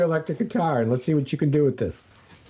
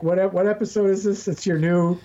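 ordinary talk

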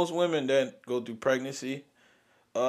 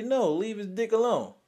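higher, emphatic mock insistence; firm refusal; 6 semitones higher than usual. Father refusing circumcision to nurse, emphatic. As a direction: shut it down hard, half joking. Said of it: "I ought to tell you".

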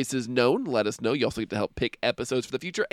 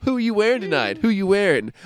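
gushing red carpet chatter; 7 semitones higher, 4 dB louder than usual. Pretend. Red carpet interviewer bit.

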